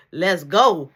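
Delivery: called out loud and punchy; loud retold let's go. Telling the story, voicing his guess at Marky, called out loud. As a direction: throw it out like a hurry-up call.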